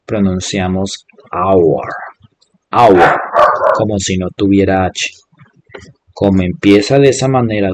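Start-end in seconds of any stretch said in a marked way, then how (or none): 1.3-3.2 "hour" slowly as "awa", no H sound; hour sin H; silent H. Shown as a demonstration. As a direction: repeat "hour" clearly with no H. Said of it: "esta H no tiene ningún sonido".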